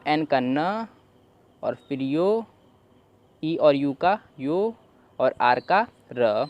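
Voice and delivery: slow ई और यू का यो; slow spelling out. Shown as a demonstration. Teacher spelling slowly for learners. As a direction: slowly spell out each letter sound. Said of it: "तो अब पूरा पढ़ लेते हैं".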